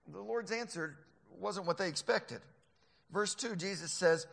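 ordinary talk, nothing marked

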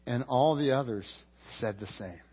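ordinary talk

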